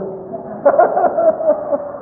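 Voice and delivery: high breathless laugh; real laugh. Real, not acted. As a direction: burst out laughing at the punchline. Laughs at his own joke. (tiếng cười thật, cao và hụt hơi)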